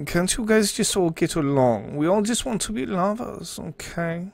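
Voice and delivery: high, mock German accent pleading; a hammed-up German pleading for peace; bad German accent. Streamer doing a bad German accent for chat. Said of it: "It's a terrible German accent".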